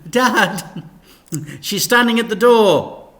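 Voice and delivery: loud urgent call. Acted. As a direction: call down the hall to Dad. Frank calling to Dad.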